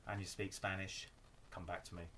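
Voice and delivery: ordinary delivery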